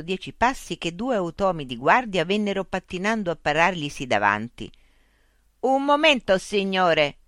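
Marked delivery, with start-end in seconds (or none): 5.6-7.2 high, loud robotic croak; croaking guard robots. Acted. Guard robots stopping Mitch, robotic croak. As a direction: croak it in unison like machines. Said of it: "gracchiarono all'unisono".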